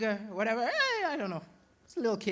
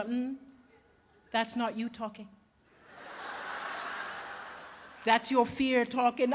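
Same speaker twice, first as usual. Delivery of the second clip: calm therapist; 3 semitones lower, 7 dB quieter than usual. Retold or imitated. Imitates calm psychologist girlfriend in argument.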